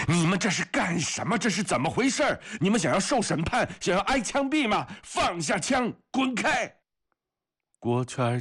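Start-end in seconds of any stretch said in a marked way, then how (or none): 0.1-6.7 very high, frantic angry yelling; roaring. Acted. Comrade pushes the fighters apart, roaring orders. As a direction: roar at them to break it up. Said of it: "夏氏吼叫着".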